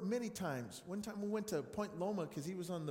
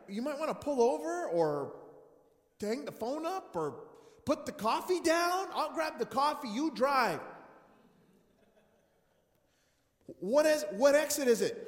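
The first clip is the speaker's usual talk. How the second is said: urgent plea; 4 semitones higher, 8 dB louder than usual. Retold or imitated. Retold plea in speeding car.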